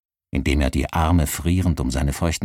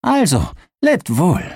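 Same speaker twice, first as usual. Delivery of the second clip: high, louder parting words; farewell call; 12 semitones higher, 5 dB louder than usual. Acted. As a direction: call out a goodbye, pitched up. Hero's farewell, pitched high. (hoher, lauter Abschiedsgruß)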